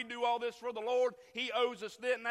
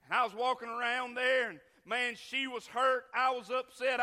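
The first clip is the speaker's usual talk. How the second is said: ordinary delivery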